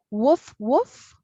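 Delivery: light barking imitation; playful woof, woof. Shown as a demonstration. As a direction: bark it out playfully. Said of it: "teacher imitates dog bark".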